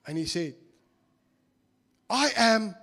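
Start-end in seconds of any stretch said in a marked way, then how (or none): 2.1-2.8 pompous teacher. Retold or imitated. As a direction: announce your name with great dignity. Teacher introduces himself gravely.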